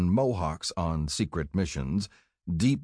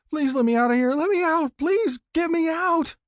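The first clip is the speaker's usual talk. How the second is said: put-on high, loud child begging for release; panicked pleading shout; 5 dB louder than usual. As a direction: plead at the top of a small child's voice. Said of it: "I shouted".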